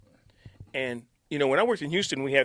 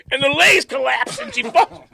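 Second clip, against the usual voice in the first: higher and louder as it collapses; excited building exclaim; 12 semitones higher, 14 dB louder than usual. Real, not acted. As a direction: build to the crash. Chair collapsing climax.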